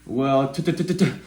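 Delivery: repeated stuttered t sounds; mock stutter. Shown as a demonstration. The speaker mimicking his own stutter, stuck on the first sound. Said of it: "me learning how to speak".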